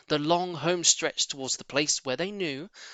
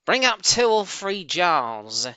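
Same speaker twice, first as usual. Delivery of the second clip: slow, louder call from upstairs; calling back up the stairs; 6 semitones higher, 5 dB louder, slower than usual. Acted. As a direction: call it down the cellar steps, drawn out. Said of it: "the other voice called back".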